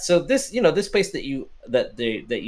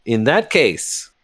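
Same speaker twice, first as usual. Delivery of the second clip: solemn, slow; 7 semitones lower, slower than usual. Acted. Bartender's solemn announcement.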